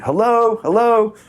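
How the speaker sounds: high, loud nervous call into the dark. Retold or imitated. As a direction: call out to an intruder you can't see. Calling out, no answer.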